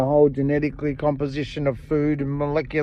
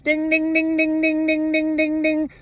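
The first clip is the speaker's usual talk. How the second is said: high sung ding-ding like a ringing bell; alarm bell ding; 13 semitones higher than usual. Retold or imitated. Alarm bell going off in your head, high ringing dings. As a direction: imitate an alarm bell, much higher, ringing out. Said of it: "an alarm bell in your head that goes".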